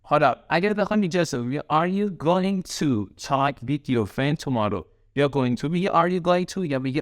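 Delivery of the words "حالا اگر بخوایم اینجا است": normal talking voice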